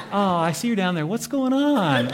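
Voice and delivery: high, loud, mock-clueless angel; obvious question. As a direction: call down with exaggerated puzzlement, drawing out the question. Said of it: "these angels ask these, like, very obvious questions".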